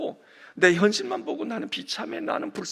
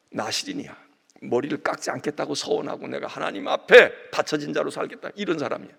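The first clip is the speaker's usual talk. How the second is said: ordinary talk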